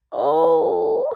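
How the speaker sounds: high, crying moan of loss; wife mourns her lost model, high and tearful; distressed moan. A narrator's reading. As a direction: moan as if heartbroken. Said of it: "Anne moaned distressfully".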